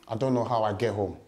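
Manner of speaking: usual speaking voice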